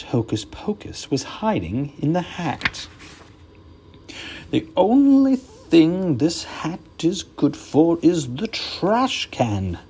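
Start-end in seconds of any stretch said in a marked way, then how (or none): 4.5-9.8 slow, disgusted magician voice; a hint of a sour stage magician; grumpy magician. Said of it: "said Professor Hinkle".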